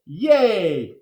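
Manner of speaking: ordinary delivery, nothing marked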